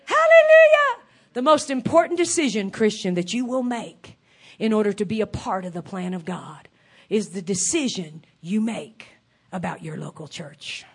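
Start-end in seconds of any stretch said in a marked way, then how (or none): 0.1-1.0 high joyful praise cry; hallelujah shout. Real, not acted. Preacher exclaims praise. 2.7-10.8 low, soft serious preaching; quiet earnest. Real, not acted. Preacher drops voice for weight.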